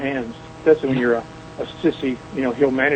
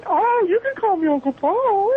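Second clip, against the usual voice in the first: very high, drawn-out wail; put-on high ghoulish manager voice; character voice; slower than usual. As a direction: stretch it out in a piercing character falsetto. Puts on his character voice.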